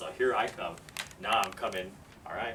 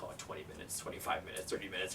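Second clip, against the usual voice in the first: soft, half-whispered count to oneself; muttered tally; 3 semitones lower, 9 dB quieter than usual. Retold or imitated. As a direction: count the minutes under your breath. Tallying lost minutes under breath.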